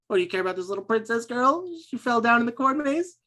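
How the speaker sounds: put-on high-pitched teen bully taunt; mocking taunt. Bully teasing Mads about the princess girl, mocking.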